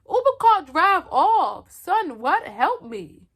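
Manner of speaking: high, loud, slowed-down panic as she relives running off; frantic retold shout. To the fleeing Uber driver, a loud high panicked plea. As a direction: call out desperately for help. Retelling fleeing the threat, calling out.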